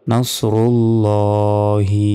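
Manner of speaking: নাসরুল্লাহি slowly, with a long rounded llo; heavy lam. Shown as a demonstration. Teacher answering how it is pronounced, slow নাসরুল্লাহি with heavy lam. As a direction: say llo, not lla, in নাসরুল্লাহি and hold it. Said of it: "লা না বলে ল বলতে হয়".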